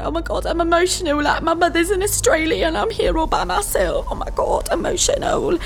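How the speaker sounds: high, fast overwrought whine; mock tipsy emotion. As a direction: ham up being drunk and teary. Mock drunk emotional quote.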